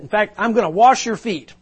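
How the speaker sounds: loud, high, grand announcement; gushing praise. Retold or imitated. Introducer announcing he will wash Tony's feet, loud and high. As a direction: announce it with a flourish. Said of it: "he talked like that for another thirty seconds".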